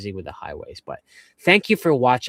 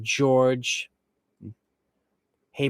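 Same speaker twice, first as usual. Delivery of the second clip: normal talking voice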